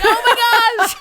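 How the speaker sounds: comic high-pitched shock; shocked exclamation. Retold or imitated. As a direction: squeal it in mock shock, laughing. Acting out startled TV reaction.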